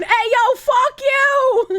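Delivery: high, loud retort; mock-offended shout. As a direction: yell back in mock outrage.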